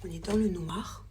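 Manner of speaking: whispering while hiding. Real, not acted. Hiding in the dark, game.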